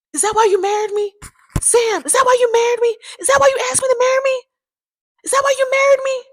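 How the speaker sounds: high, loud, rapid accusing rant; wife screaming at husband in a fight, high and loud; neighbor going off. Retold or imitated. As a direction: hurl the question at her husband again and again. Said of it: "Lisa was going off".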